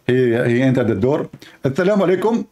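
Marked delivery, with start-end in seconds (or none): 1.6-2.5 husband greeting. Acted. Husband greets wife, acted skit.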